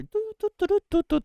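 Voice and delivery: high, loud sung doo doo of a scanning machine; detector beeping noise. Pretend. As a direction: imitate a gadget scanning with rising beeps.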